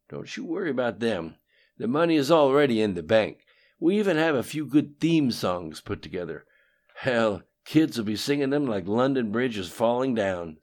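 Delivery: lightly voiced cocky politician; Bill's voice. A narrator's reading. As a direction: brag about old schemes, loud. Bill brags about plans.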